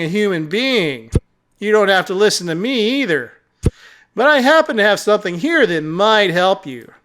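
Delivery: lightly voiced cat character, smugly testing; condescending talking cat. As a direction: lightly play a patronizing cat guide.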